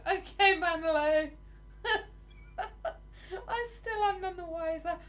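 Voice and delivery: high-pitched words through giggles; helpless laughter. Real, not acted. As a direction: still cracking up while admitting confusion. Laughing helplessly at viewer's comment.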